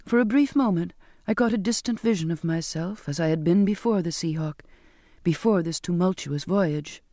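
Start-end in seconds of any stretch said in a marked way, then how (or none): none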